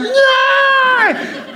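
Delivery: very high, wailing no; crying child's no. Retold or imitated. Charlie crying under the pile of kids, a high wail. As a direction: wail like a frightened little boy. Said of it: "Charlie was going".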